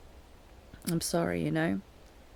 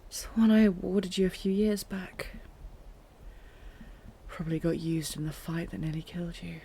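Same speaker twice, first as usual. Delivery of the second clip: ordinary talk, nothing marked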